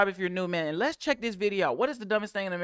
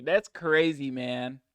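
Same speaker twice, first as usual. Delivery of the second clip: ordinary talk, nothing marked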